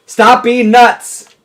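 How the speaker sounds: loud, high, drawn-out order; yelled command. Real, not acted. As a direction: yell it slowly so it gets through. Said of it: "He doesn't understand that?".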